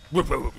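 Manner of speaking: loud imitated dog woofs; playful barking. Player barking at a game character, dog imitation. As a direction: bark it out like a dog. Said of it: "Maybe if we bark at her".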